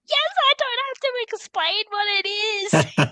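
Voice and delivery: high, loud, overjoyed exclamation; excited outburst. Retold or imitated. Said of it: "I do get excited as well".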